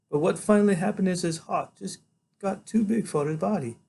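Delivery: high, gentle character voice; tender woman doctor. A narrator's reading.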